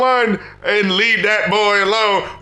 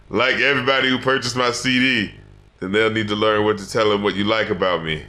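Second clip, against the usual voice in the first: imitated rapper outro; 11 semitones lower than usual. Co-host quoting a puzzling song outro, low rapper imitation. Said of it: "Like, when he's like".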